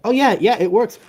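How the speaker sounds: high, loud relief; excited. Real, not acted. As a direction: celebrate that it works. Mic finally works.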